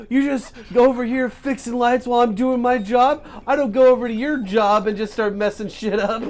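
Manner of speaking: loud, high-pitched mock rant. Actor yelling at the light guy on set, mock freak-out. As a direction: imitate an actor furiously chewing out a crew member. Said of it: "he, like, freaked out".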